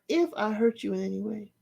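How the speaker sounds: soft, scornful echo of the apology; quietly mimicking a hollow apology; mocking repeat. Shown as a demonstration. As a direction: repeat her words with quiet disdain. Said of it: "So I'm like".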